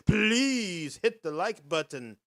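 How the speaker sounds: ordinary talk, nothing marked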